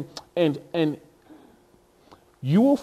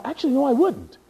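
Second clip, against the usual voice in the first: usual speaking voice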